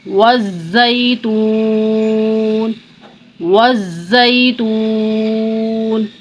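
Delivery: slow chanted tajweed recitation; Quran recitation. Shown as a demonstration.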